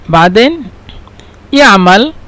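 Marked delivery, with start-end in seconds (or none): none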